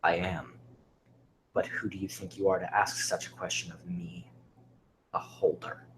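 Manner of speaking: low, hushed menace from an ancient being; quiet, deep-voiced challenge; otherworldly being's reply. A narrator's reading. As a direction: answer low and soft, trailing into near-whisper.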